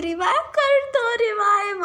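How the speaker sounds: high, loud call to be revived; calling for revive. Retold or imitated. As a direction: shout out to teammates for a revive. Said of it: "मैं बंदों से कह रही हूँ".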